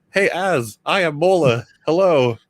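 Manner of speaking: mock stilted greeting. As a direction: parody wooden dialogue between old friends. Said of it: "It's like, it's not human".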